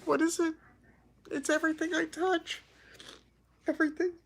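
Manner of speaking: ugly crying, voice pitched high; talking through tears and sniffles; snotty crying. Real, not acted. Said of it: "this is the ugly cry".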